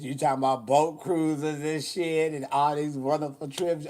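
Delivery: normal talking voice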